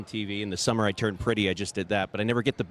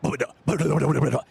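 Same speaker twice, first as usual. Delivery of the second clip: higher, rushed mock gabble of lines; fast gibberish; 4 semitones higher, 5 dB louder, much faster than usual. Mimicking Kiefer rattling off his lines as a fast gabble. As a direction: rattle off nonsense syllables as fast as possible. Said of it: "it sounded like he said".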